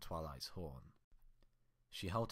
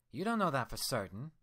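louder, higher angry growl; 7 semitones higher, 7 dB louder than usual. Acted. Twilight growling at her reflection, angry and loud. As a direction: snarl it out defensively. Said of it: "she growled".

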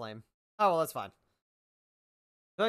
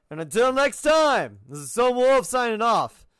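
outro sign-off; 3 semitones higher, 7 dB louder than usual. Acted. Outro catchphrase.

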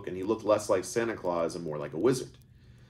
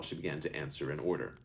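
usual speaking voice

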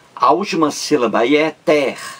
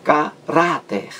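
"Caráter" lento, destacando o RÁ; ênfase no segundo A; 3 semitones higher, slower than usual. Shown as a demonstration. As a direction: separe o ca e acentue o RÁ de "Caráter". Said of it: "sobre o segundo A". ("Caráter" pausado, com força na penúltima sílaba)

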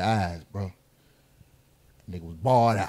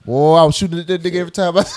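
laughing shout; 9 semitones higher than usual. Real, not acted.

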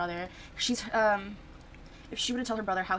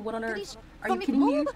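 mock mad mom; 5 dB louder than usual. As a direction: build to an outraged mom's yell. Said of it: "She was so mad".

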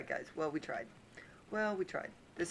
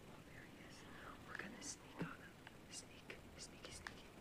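sneaky whisper; 15 dB quieter than usual. Pretend. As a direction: whisper like creeping up behind someone.

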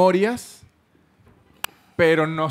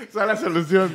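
laughing; 3 semitones higher than usual. Real, not acted. Laughing agreement about burning agencies.

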